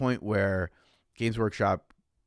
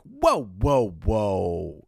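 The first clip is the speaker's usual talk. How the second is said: surprised whoa; 6 dB louder, much slower than usual. Retold or imitated. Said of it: "I'm like".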